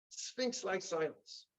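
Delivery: slow, soft drop into stillness; hushed. Real, not acted. Storyteller evoking the silent mikveh, hushed. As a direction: let it go quiet and slow.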